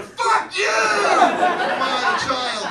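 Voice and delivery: high, loud burst of abuse; hammed-up angry shout. Priest swearing at a parishioner in the cold, mock shout. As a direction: burst into mock yelling, then ease down slightly.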